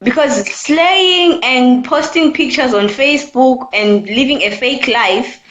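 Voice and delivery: normal talking voice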